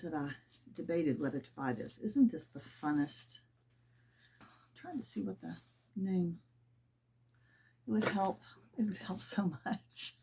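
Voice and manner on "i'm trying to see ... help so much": breathy whisper, murmuring while she reads a label; whispering to herself. Real, not acted. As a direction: whisper it under your breath, half to herself.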